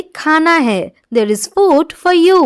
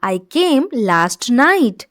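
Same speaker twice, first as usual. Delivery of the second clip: slow model reading; 3 semitones lower, 3 dB quieter, much slower than usual. Shown as a demonstration. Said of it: "teacher repeats the English sentence".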